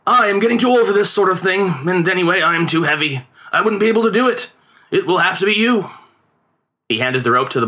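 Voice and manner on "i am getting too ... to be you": higher, coaxing father; lightly voiced father, mildly wheedling; father excusing himself. A narrator's reading. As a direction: talk the boy into doing it. Father persuades son to climb.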